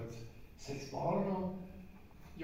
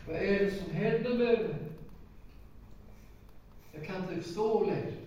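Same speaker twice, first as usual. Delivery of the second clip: desperate cry; 4 semitones higher than usual. Retold or imitated. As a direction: call out to his wife, panicking. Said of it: "Och jag ropade till Rune".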